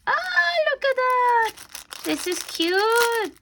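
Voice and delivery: high, slow, gushing delight; squealing over a present. Real, not acted. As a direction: coo over the cute gift.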